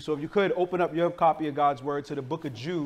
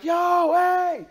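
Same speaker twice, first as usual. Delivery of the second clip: high, loud drawn-out call; mimicked shout to anyone in earshot; yelling for help; 11 semitones higher, 5 dB louder than usual. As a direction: call out as if stranded, voice pitched way up. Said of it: "yelling like".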